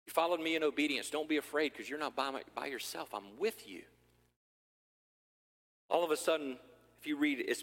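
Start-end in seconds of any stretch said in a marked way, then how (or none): 0.0-3.8 softening to a quiet, gentle promise; Jesus comforting his scared disciple, tender and quiet; tender reassurance. Acted. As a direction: comfort him quietly, fading down. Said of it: "And he says".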